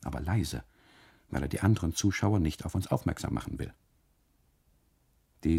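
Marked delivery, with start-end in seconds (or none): none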